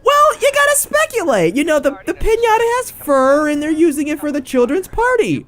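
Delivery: squeaky high earnest nerd voice; very high-pitched mock pedantry; mocking co-host imitation. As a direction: mimic her speculating in falsetto. Mocks Tori's earnest speculating.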